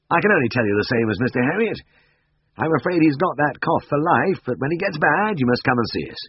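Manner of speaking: kindly but firm professional; Siegfried voiced. A narrator's reading. Vet telling old man the cough is for life, firm.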